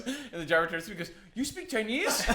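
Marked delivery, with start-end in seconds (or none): 1.3-2.2 high, rising, delighted question; imitated excitement. Uber driver thrilled at a passenger, imitated excitement. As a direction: mimic an excited driver, pitched way up. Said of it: "like, so excited about it".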